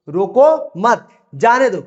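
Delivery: slow रोको मत, break, then जाने दो; pause after मत. Shown as a demonstration. Teacher showing where the comma falls, pause after मत. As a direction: stretch it out and stop after मत before जाने दो. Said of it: "थोड़ी सी रुकने के लिए".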